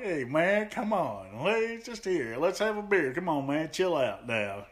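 lightly voiced surfer buddy; surfer dude. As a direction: coax him to have a beer, laid back. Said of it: "Rusty's like".

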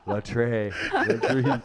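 put-on French accent; mock French voice. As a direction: play a jokey Frenchman.

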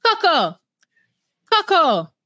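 high-pitched Coco, first vowel between "cuck" and "co"; mock an odd, high "Coco"; Jasmine. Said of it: "the way Jasmine says Coco".

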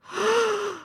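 quick audible intake of breath; sharp gasp. A narrator's reading. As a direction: gasp in before the pounce. Tense moment before tiger pounces.

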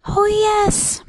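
very high, loud little pipe; high-pitched tiny voice; piping voice. A narrator's reading. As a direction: pipe up eagerly from a tiny creature. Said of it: "piped the voice".

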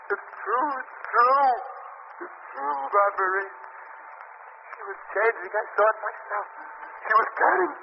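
haunted by what he saw; distraught insisting. Acted. Bill insisting his wife turned into a snake, frantic.

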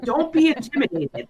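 high, mocking repeat; mock the guide's words. Mocking quote disbelief.

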